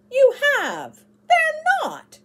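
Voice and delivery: put-on a child losing his temper, screaming high; shouting. A narrator's reading. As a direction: yell it back in a temper. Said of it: "shouted David".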